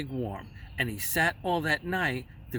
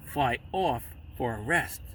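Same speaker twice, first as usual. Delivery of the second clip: usual speaking voice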